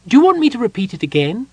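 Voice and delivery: high, loud and fast Kender eagerness; eager Kender. A narrator's reading. Tass eagerly offers to repeat the rhyme. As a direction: rush the offer, bright and high.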